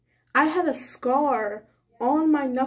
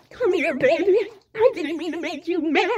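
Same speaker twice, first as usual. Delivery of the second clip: higher, mock-coaxing imitation; girl apologizing to her, in a higher mock-coaxing voice; 5 semitones higher than usual. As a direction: coax her like a baby, teasingly.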